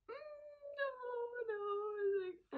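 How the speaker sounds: high, wailing fake cry; mock bawling. Teen confronted by Dad, high mock-crying wail. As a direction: act out bawling in a squeal. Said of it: "I start bawling my eyes out".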